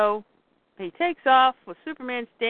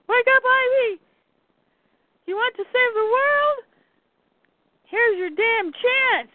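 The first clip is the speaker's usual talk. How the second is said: much higher retold yell, slowing at the end; urgent rousing; 12 semitones higher than usual. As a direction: shout someone awake with a challenge. Cat shouting Ivy awake.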